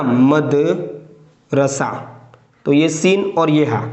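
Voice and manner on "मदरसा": slow spelled-out word. Shown as a demonstration. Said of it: "जैसे मदरसा लिखते हैं ना".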